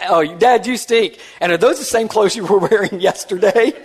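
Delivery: put-on high, childlike, with a giggle; five-year-old voice. A five-year-old in his lap telling Dad he stinks, high and giggly. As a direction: tease Dad like a small child. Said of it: "and then said".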